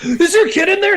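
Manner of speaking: high-pitched mock alarm; alarmed bystander. Driver retelling a stranger's car seat question, mock alarm. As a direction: imitate someone panicking over a stranger's child.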